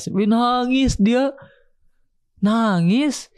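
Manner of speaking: high, drawn-out astonishment; slow, high-pitched exclaiming; amazed exclamation. Retold or imitated. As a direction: marvel at it, stretching the words high. Exclaiming that she cried.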